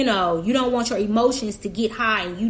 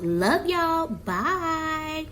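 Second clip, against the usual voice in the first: sweet sign-off; 4 semitones higher, much slower than usual. Real, not acted. Vlogger signs off to viewers.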